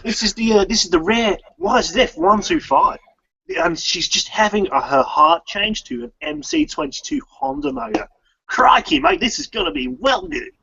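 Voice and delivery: weak Australian accent attempt. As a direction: try an Aussie accent, badly. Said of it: "teach me how to talk Australian".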